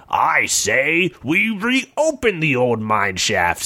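slow, pompous mayor. Acted. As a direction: announce it slowly like a mayor. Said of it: "said the mayor".

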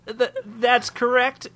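high, slow, stammering whimper; timid gnome whimpering out a halting reply. A narrator's reading. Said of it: "whimpered Toodlin".

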